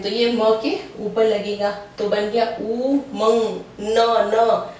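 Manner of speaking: slowly joining ऊ and मंग; slow spelling out. Shown as a demonstration. Teacher builds the word slowly for students. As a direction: draw out मंग so the class can follow. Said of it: "याद रखना है".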